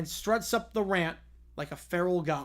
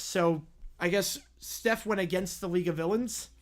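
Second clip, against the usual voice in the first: ordinary talk, nothing marked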